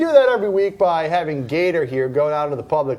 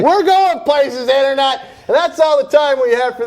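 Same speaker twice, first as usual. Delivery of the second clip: excited; 6 semitones higher, 5 dB louder than usual. Acted. Host's hyped sign-off.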